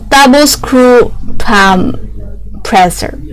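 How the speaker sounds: ordinary talk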